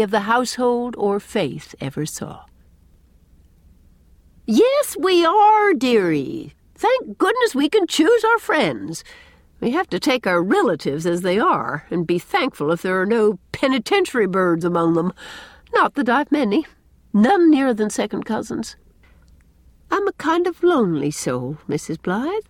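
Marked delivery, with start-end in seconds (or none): 4.5-18.8 emphatic high opening, easing into confidences; Miss Cornelia, hearty then confiding. A narrator's reading. 19.9-22.4 a touch of wistfulness; wistful. A narrator's reading. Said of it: "There was a wistful note".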